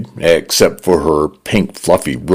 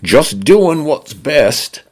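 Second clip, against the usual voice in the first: Cockney-leaning British; 9 semitones higher, slower than usual. A narrator's reading. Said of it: "in a male British voice".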